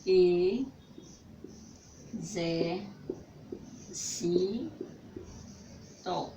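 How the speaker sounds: e, zé, si, to, syllable by syllable with pauses; separated syllables. Shown as a demonstration. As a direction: split "exército" into e-zé-si-to, each syllable apart. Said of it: "Vamos separar esta palavra". (sílabas separadas, uma por uma)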